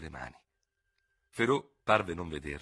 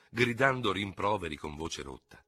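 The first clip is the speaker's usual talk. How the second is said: ordinary delivery, nothing marked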